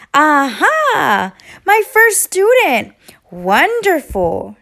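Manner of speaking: little yellow bird greets her first student with excitement; sing-song bird voice. A narrator's reading. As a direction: chirp it out like a delighted little bird, going up high on first. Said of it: "she sang".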